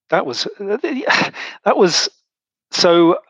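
choked up, high and halting, slow; fighting back tears as the memory hits; words catching in the throat with a sigh; voice breaking with emotion. Real, not acted. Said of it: "sorry, I might get emotional on this one".

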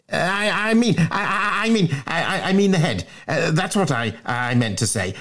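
high, stuttering schoolboy; flustered excuse-making. Acted. Bunter flounders through excuses.

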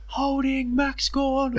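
Razor calling a free. Retold or imitated. Umpire Razor calling a free on TV, high and drawn out. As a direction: sing out the free kick call slowly, up high.

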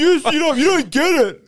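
put-on high, sulky kid snapping at mum; whiny little sook. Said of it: "Like a little sook".

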